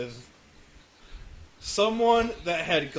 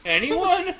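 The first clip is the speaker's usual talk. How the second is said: laughing; 7 dB louder than usual. Real, not acted. Laughing after his joke.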